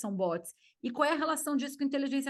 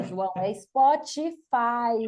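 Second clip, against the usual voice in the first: "é Spotify" spelled out slowly and louder to the husband; slow correcting emphasis; 6 dB louder, much slower than usual. Retold or imitated. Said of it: "Eu falo". (corrigindo o marido, devagar e mais alto)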